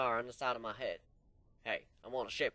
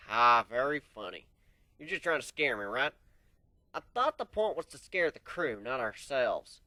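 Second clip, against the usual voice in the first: dismissive; 8 dB louder than usual. Acted. Pony laughs off the scare.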